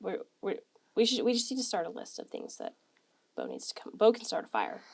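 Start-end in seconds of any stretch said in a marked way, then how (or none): none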